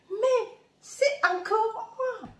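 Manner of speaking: high, slow laughing aside; self-mocking laughing remark. Real, not acted. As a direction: laugh at yourself, voice jumping up. Laughs about her own accent.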